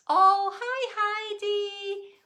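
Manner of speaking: high, loud, gushing hello; delighted greeting. Real, not acted. Teacher greeting a child who joins, delighted.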